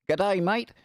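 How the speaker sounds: a quick jokey Aussie g'day; playful hint of an Australian accent. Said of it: "it's an Australian".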